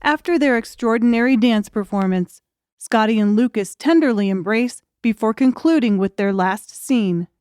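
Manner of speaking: ordinary delivery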